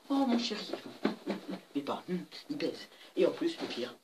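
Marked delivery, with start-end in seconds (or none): 0.1-0.7 high, cooing mock "oh mon chéri"; mock mother's voice. Kid mimicking the mother cooing at her son, high coo. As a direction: coo like a doting mother, hammed up. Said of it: "Après, je fais".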